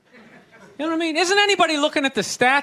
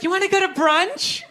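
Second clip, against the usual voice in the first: mock girlfriend; 5 semitones higher than usual. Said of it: "they'll be like".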